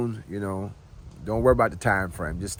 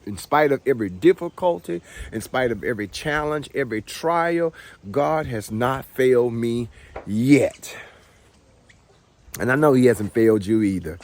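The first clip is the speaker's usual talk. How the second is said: louder, rising preacher's cadence; testifying; 3 semitones higher, 4 dB louder than usual. Real, not acted. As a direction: testify like in church. Testifying about God.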